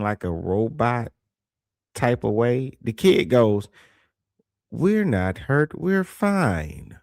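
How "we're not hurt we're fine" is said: slow, higher, lightly voiced frightened kid; imitating a scared child. Streamer imitating the caged kid at the door, slow, lightly robotic. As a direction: mimic a trained, scared kid, slowly and flatly. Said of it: "how afraid those kids would be ... like, a robot".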